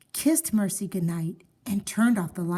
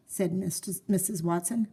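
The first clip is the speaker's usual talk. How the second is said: usual speaking voice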